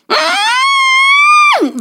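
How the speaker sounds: loud, very high closed-mouth scream; muffled scream. Retold or imitated. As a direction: imitate screaming in pain through closed lips. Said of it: "her mouth was closed".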